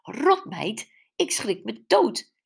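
startled scolding, high and loud. Acted. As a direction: scold as if just frightened out of your seat. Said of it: "vloog geschrokken uit haar tuinstoel".

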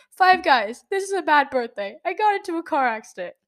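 high, loud mock panic; freaking out. Daughter repeating herself to dad by phone, freaking out. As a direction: re-enact frantic, whiny repeating. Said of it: "I'm freaking out".

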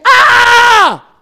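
very high, loud drawn-out "ah"; acted scream of alarm; alarmed cry. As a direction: yell one long, high cry of shock. Assassin alarmed at hearing "pastor.

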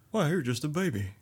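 [name] voice, laughing. Pretend. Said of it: "he said and started laughing".

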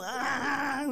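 high, loud imitated preacher's squall; mock squall. Teacher showing the squall he won't do, mock cry. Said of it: "You ain't gonna get no".

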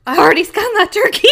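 loud panicked shout from a nightmare. Retold or imitated. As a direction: yell it in panic, high. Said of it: "I said".

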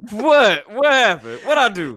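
high, loud, laughing what-did-I-do; laughing mock innocence. Real, not acted. Teased by his cohost, he protests laughing and innocent. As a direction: play innocent through a laugh.

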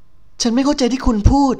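put-on high, slow bewildered young woman; a lightly voiced heroine pleading to her attacker; confused protest. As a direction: plead that you don't understand.

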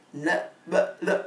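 imitating halting, broken-off stammer; mock tongue-tied hesitation; stammered answer. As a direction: start to answer and falter on each syllable. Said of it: "Gagap menjawabnya".

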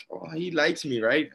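naive thought. Retold or imitated. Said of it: "and then I was like".

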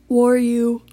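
words stretched by a yawn; real yawning. Real, not acted. Tired podcaster apologizing to listeners mid-yawn, yawning. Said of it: "sorry, I'm yawning".

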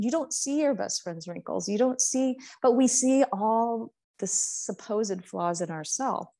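usual speaking voice

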